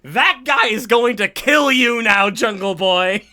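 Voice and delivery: loud, threatening callout; hammed-up heel warning; menacing announcement. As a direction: bellow the threat at Jungle Boy. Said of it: "Lance Archer to walk in through the door going".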